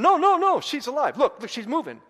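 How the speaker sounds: high, loud, desperate insisting; frantic pleading. Retold or imitated. Boy insists to the driver his dog lives, frantic. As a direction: beg them to see she's alive. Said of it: "And I'm going".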